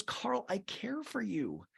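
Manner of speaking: imitating a choked-up confession; tearful plea. Host imitates crying Maya telling Carl she cares, soft and teary. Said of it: "Maya starts crying".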